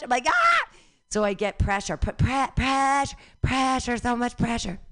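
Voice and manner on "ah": sharp high yelp of pain; sudden shriek of pain. Retold or imitated. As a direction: yelp like you just cut yourself. Yelps after snipping herself.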